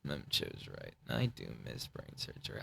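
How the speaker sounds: breathy whisper, barely voiced; soft unvoiced murmur; whispered line. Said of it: "Another line I improvised".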